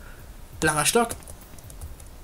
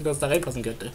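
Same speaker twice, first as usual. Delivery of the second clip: ordinary talk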